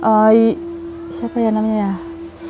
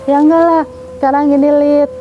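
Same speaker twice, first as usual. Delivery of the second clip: higher-pitched, mildly insistent rebuttal; retold protest; 5 semitones higher than usual. Friend answering her doubts, in mild protest. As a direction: imitate a friend setting her straight.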